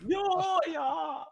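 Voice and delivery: very high German "ja"; squeaky German caricature; camp mock German. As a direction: agree in a silly, high German voice. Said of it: "incredibly camp".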